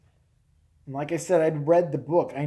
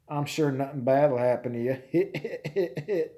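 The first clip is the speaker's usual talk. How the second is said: slow, drawling Southern local; Southern yokel; 3 semitones lower, slower than usual. Acted. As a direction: lure them along in a mock Southern drawl. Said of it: "local yokels are hanging out".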